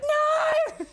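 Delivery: very high, loud drawn-out shriek; piercing mock scream; imitated scream. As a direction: mimic her screaming out in horror. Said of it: "like, screaming".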